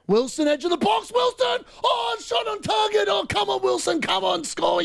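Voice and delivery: excited shouting. Real, not acted. Fan urging Wilson to score, excited yelling. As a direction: roar him on as the shot goes in.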